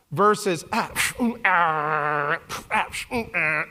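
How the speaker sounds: overwhelmed hemming and hawing; flustered hemming. Shown as a demonstration. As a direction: stammer helplessly like an overloaded mind. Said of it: "if you wanna know what it feels like".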